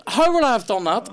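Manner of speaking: high, loud retold disbelief; incredulous. Pedestrian protesting the policeman's accusation, incredulous. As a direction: throw the question back, high and loud.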